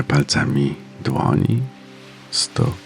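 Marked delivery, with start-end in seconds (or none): none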